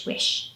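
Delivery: breathy unvoiced swish; whispered swish. Shown as a demonstration. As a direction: brush the sound out softly. Wiper sound demo.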